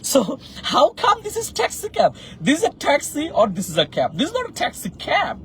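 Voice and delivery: high-pitched, laughing incredulity; playful mock bafflement; amused disbelief. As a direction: question it as if it's absurd, voice leaping high. Mocking the term taxi cab.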